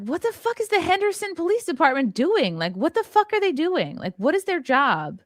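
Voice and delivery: high, exasperated demand for answers; venting years of frustration at the police; outraged disbelief. Retold or imitated.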